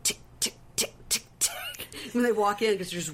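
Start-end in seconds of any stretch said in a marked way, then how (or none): 0.0-1.5 soft voiceless clock ticks; imitated ticking. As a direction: tick like a time bomb counting down. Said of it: "Can you hear it?".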